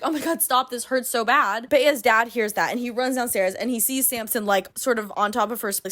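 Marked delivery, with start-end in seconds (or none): none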